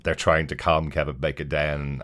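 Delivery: normal talking voice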